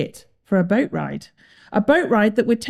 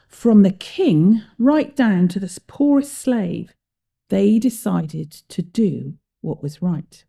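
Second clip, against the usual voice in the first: usual speaking voice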